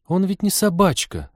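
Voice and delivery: high-pitched indignant protest; mildly outraged, voice lifted high. A narrator's reading. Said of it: "возмутилась и Полина".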